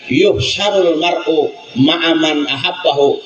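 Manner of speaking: slow, measured recitation of the Arabic words; Arabic hadith recitation. Acted. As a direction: recite it slowly like scripture.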